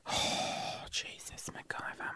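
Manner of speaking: whispered, exhaled muttering; sighed aside. Real, not acted. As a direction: let it out under your breath after a rant. Sighed aside after the rant.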